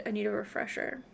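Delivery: usual speaking voice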